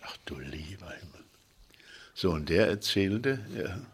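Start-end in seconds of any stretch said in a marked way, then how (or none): 0.0-1.2 very soft, voiceless whisper to oneself; dismayed whisper. Retold or imitated. Village boy to himself as the pastor returns, dismayed whisper. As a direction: whisper a retold private exclamation of dismay.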